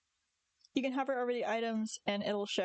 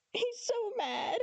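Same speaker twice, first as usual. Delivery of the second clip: high, squealed through real laughter; laughing; 12 semitones higher, slower than usual. Real, not acted. Owner amused by her mad cat, high laughing squeal. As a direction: crack up, pitch shooting up.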